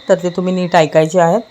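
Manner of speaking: ordinary talk, nothing marked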